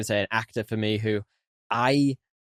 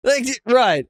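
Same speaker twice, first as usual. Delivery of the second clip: high, airy sarcastic shrug; sarcastic high-pitched nonchalance; mock breezy dismissal; 9 semitones higher, 5 dB louder than usual. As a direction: brush it off in a high mocking voice. Mocking the film's blithe attitude.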